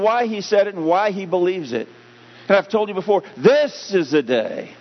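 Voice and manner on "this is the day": THIS drawn out and punched, slow line; stress on THIS. Shown as a demonstration. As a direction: lean hard on the word THIS. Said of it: "wherever you put the emphasis".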